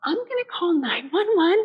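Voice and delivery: mock-urgent friend taking charge; worried friend. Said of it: "She goes".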